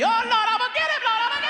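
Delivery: very high, loud wailing vow; shouting in church. Retold or imitated. Mad mother seems to shout to the Lord, wailing. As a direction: cry out like a fired-up church mother. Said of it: "they thought she was shouting".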